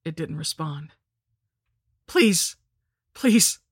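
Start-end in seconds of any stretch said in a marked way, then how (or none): 2.1-3.6 loud, high frightened begging; two sharp, desperate cries; panicked plea. A narrator's reading. Dudley begging from the altar, in a panicked high cry. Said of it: "Dudley's eyes rolled with panic".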